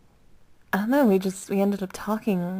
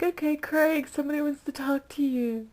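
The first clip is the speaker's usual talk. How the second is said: lightly voiced, higher relay to Craig; imitating Joe; 7 semitones higher than usual. Joe calling Craig to the phone, lightly imitated. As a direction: hand the phone over like a friendly guy. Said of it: "And Joe's like".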